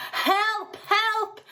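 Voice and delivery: put-on high, odd boy's voice crying help; boy calling for help from the river; yelled for help. A narrator's reading. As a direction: cry out from the chocolate. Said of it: "he yelled".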